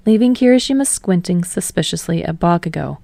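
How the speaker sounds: ordinary delivery, nothing marked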